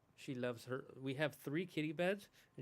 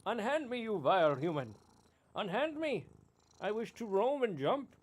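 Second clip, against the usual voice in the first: high, loud mock-royal cat protest; cat's indignant voice; 6 semitones higher, 9 dB louder, slower than usual. Owner voicing his squirming cat, high loud haughty protest. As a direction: voice the cat demanding to be let go. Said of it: "She's like".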